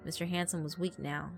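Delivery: usual speaking voice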